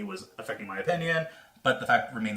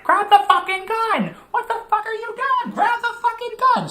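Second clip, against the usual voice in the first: very high, loud frantic scream; high-pitched yelling at a movie character; 7 dB louder than usual. Retold or imitated. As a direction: scream it at the screen, shrill and urgent. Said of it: "I'm, I'm screaming".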